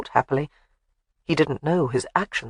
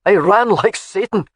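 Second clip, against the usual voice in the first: loud Scottish boast; 4 semitones higher, 6 dB louder than usual. A narrator's reading. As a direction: brag it out proudly. Alec boasting.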